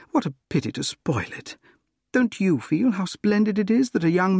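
high-pitched woman's voice, appealing to a friend; acted heroine, lively and high; Gladys, earnest. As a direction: raise the pitch and reason with him feelingly. Voices Gladys reasoning with her suitor.

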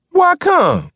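very high, loud keening argument. Acted. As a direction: wail it in argument. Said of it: "argued in a high, keening voice".

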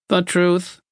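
high, monotone box voice; machine verdict. A narrator's reading. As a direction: pronounce it like a machine. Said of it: "the box said".

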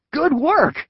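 high, excited yell; high cheering boy; Zach yelling. A narrator's reading. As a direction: cheer your partner on. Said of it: "yelled Zach".

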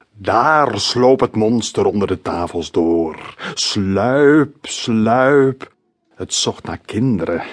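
slow, creaky spooky storyteller voice; teacher telling a monster tale to his class in a creaky creepy voice; creepy croaky voice. A narrator's reading. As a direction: drag out each word like a lurking monster. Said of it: "met zijn griezelstem".

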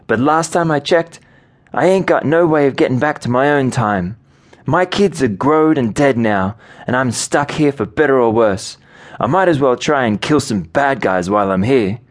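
high-pitched voice for a woman answering back; defensive woman character. Acted. Daughter answering father's voice in her head, high and defensive.

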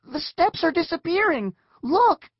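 high, loud cry of alarm; panicked alarm. Acted. Julie seeing the stairs vanish, loud high panic. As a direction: shout a warning, loudest on the last word.

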